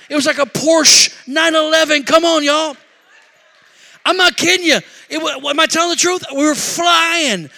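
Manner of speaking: high, loud, carried-away storytelling; preacher at full pitch; excited testifying. Real, not acted. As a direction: shout it to the congregation, insisting it's true. Excited preacher insisting on wild story.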